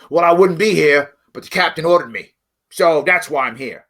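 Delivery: a lightly voiced gruff vet excuse; tough vet. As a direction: grumble it like a proud soldier. Voicing a vet's excuse.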